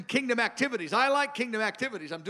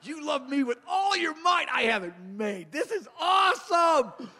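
joyful laughing shout; 6 semitones higher than usual. Shown as a demonstration. Preacher exulting in God's love, laughing aloud. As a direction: burst out laughing with delight. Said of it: "I just love to say".

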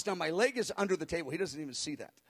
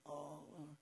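very soft imitated weeping prayer; barely audible tearful plea; gently weeping; 4 semitones lower, 18 dB quieter than usual. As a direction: act out a quiet, weepy prayer. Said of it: "little gently weeping".

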